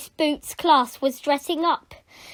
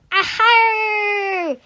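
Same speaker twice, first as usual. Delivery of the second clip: high, loud, drawn-out battle cry; long rallying whoop; pirate yell; 8 semitones higher, 6 dB louder than usual. Acted. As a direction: yell like a charging pirate crew. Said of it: "yelled Darren and Eugene".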